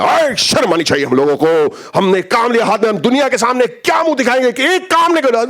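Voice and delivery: rapid, high indignant tirade; staged outrage. Acted. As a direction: put on a big show of outrage, fast and high. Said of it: "I've never acted better. Performance".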